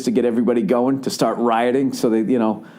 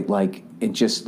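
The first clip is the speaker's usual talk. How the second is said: usual speaking voice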